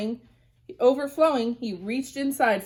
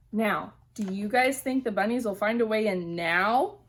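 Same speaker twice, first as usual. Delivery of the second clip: plain reading voice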